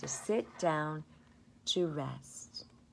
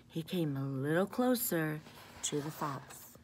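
ordinary delivery, nothing marked

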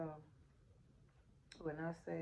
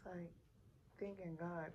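ordinary talk

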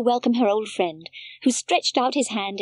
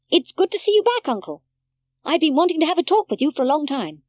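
put-on high, loud, quick child's welcome; a child thrilled to see him back; excited child greeting; 6 semitones higher, 4 dB louder, faster than usual. A narrator's reading. Village child welcomes the uncle home, high and eager.

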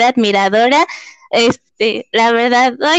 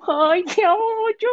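higher, softer, tearful voice; about to cry; 7 semitones higher, 6 dB quieter than usual. Real, not acted. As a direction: voice breaking with emotion. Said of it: "voy a llorar".

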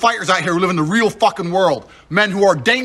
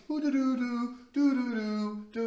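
carefree mock humming, softer; mocking hum; 3 semitones higher, 13 dB quieter, slower than usual. Mocking a man strolling with his water bottle, soft carefree humming. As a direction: hum lightly like a dainty stroller.

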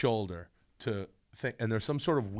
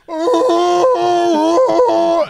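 piercing high shriek; sudden scream; 13 dB louder than usual. Retold or imitated. Shrieking at the person who asked what that was. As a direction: scream them out of the room. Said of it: "I go".

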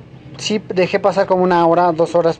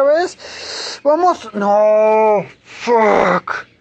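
frustrated yell; 3 semitones higher, much slower than usual. Real, not acted. Gamer failing the jump again, anguished yell. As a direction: scream it as the jump fails.

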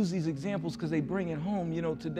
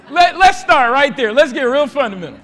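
excited preaching; 10 semitones higher, 14 dB louder than usual. Real, not acted. Laughing preacher pressing the church.

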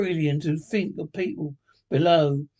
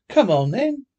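gaily; 6 semitones higher, 7 dB louder than usual. A narrator's reading. Nephew answering his grumpy uncle, gaily. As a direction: jump in merrily, voice lifted. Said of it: "nephew gaily".

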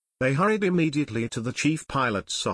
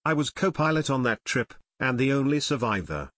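ordinary delivery

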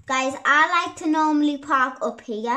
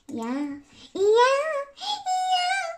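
each yeah climbing higher; playful rising squeals of yeah; silly squeaky yeahs; 14 semitones higher, much slower than usual. Real, not acted. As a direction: build up to a high-pitched squeal. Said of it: "I don't know what got into me".

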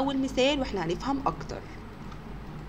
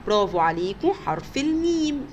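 normal talking voice

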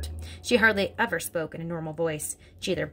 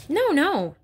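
high and louder cry of protest; cried out; 9 semitones higher, 5 dB louder than usual. Acted. Miss Honey protesting to headmistress, high cry. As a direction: cry out to stop her. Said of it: "Miss Honey cried out".

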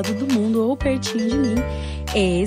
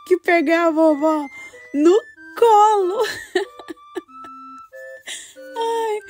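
laughing; 10 semitones higher than usual. Acted. Laughing while telling the grandma story.

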